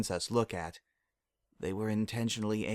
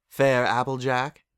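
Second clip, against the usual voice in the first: calling out; 3 semitones higher, 6 dB louder than usual. Acted. Luna calls again.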